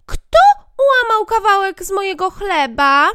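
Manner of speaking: high, loud squeaky dwarf. Acted. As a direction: pipe up like a tiny bearded man. Third dwarf asks. (wysoki, głośny krasnoludek)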